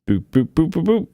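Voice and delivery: playful rising boops; boop sound effect. Real, not acted. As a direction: beep it out like a button press. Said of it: "unmuting sound effect".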